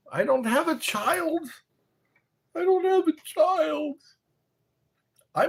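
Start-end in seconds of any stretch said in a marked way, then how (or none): 2.6-4.0 much higher, comic falsetto take; high mock-whiny repeat; squeaky high repeat. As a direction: say it again way up high. Repeating line in silly voice.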